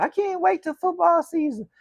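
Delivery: high, excited fan voice, teasing; mock eager fan. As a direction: squeal it like an impatient fan. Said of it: "You guys tell me all the time".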